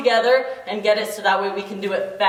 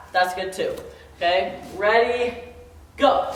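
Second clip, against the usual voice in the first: normal talking voice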